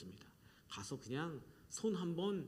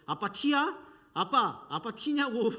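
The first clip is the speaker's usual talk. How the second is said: mocking kids; 5 semitones higher, 7 dB louder, slower than usual. Kids mocking their father.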